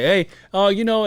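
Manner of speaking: a lifted, half-protesting hey to his wife. Retold or imitated. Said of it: "I tell my wife when I go and I say".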